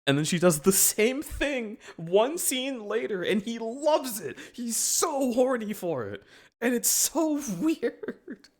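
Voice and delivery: high, slowed, giggling commentary; giggly high voice. Real, not acted. Recapping Sokka loving it, giggling high. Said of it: "laughing high voice".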